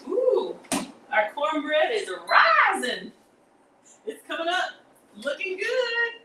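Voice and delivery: very high, excited sing-out; delighted crowing. Real, not acted. Cook cheering on the rising cornbread, high delighted crowing. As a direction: crow with delight, high and drawn out.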